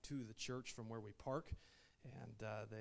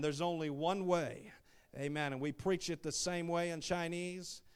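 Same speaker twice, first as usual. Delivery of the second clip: loud, driving sermon delivery; full-force preaching; 3 semitones higher, 7 dB louder than usual. Real, not acted. Preacher telling the church there is one way for everyone, forceful preaching. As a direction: hammer each point home to the congregation.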